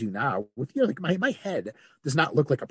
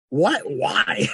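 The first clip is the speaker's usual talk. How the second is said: high, loud, incredulous question; amused disbelief; 7 semitones higher, 6 dB louder than usual. Retold or imitated. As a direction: question him in amused bafflement. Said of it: "I was, like, mostly amused".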